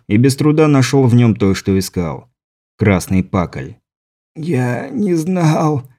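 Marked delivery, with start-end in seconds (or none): 4.4-5.9 slow, soft, pained groan; moaning in pain. A narrator's reading. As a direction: push the words out through the pain. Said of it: "простонал Фёдоров". (стон от боли, медленно и тихо)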